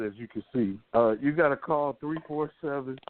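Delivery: ordinary delivery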